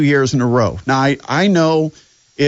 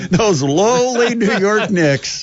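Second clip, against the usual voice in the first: drawn-out mocking praise; slow, sarcastic emphasis on lowly; 6 semitones higher, slower than usual. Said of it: "I hope everybody understands my sarcasm".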